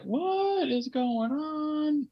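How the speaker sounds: high, very slow, stunned what; shocked husband retelling his confusion in a squeaky voice; baffled high squeak. Retold or imitated. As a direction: stretch it out in high disbelief. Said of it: "I was like".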